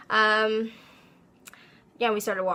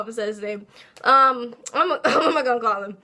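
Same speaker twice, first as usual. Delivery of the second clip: usual speaking voice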